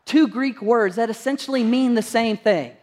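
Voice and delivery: ordinary talk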